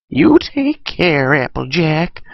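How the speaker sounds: high, creaky old granny voice, badly done; a clumsy old grandma impression; Granny Smith voice. Old granny asking Applejack about her journey, high quavering.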